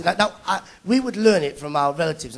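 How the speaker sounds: normal talking voice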